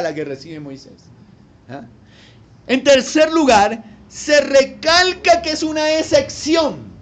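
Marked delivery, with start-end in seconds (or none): 2.7-6.9 higher, louder emphatic preaching; full-force preaching. Acted. Preacher pressing his points on the congregation, loud and emphatic. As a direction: drive each point home.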